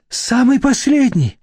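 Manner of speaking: eager high exclamation; exclaiming. A narrator's reading. Deputy director exclaims to Nadezhda, pleading for one last chance. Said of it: "воскликнул тот".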